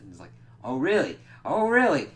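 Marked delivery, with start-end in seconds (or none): 0.6-1.2 a loud, sarcastic "Oh, really?"; mocking challenge. Johnny scoffing loudly at the pleading demons. 1.4-2.1 "Oh, really?" pitched much higher and louder, incredulous; escalated mocking challenge. Johnny mocking the demons, louder and higher.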